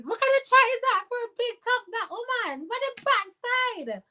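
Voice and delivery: high-pitched scornful question; mocking disbelief. Real, not acted. Mocking a commenter's tough talk, high scornful disbelief.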